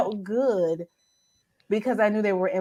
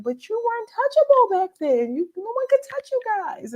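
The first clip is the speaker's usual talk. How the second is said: fangirl excitement. Retold or imitated. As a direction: gush at your favourite authors. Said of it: "I fangirl and get excited".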